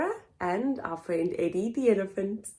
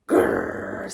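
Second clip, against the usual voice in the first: very low growl; deep playful growl; monster growl. Acted. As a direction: growl like a furry monster behind the door. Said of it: "said a voice".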